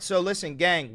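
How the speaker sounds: ordinary talk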